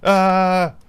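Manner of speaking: sudden high yelp; a short surprised cry; startled yelp. Real, not acted. Startled by cat.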